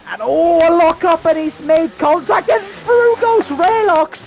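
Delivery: loud, high, rising race call; excited shouting commentary. Real, not acted. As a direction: yell the crash and the pass. Lock up and contact, live call.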